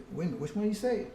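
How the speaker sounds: normal talking voice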